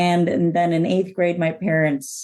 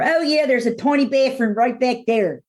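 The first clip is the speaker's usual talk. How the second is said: high-pitched, open-mouthed Baltimore vowels; open Baltimore accent; 7 semitones higher than usual. Shown as a demonstration. Guest showing how local vowels open up, broader Baltimore accent. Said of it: "open their mouths a little bit more".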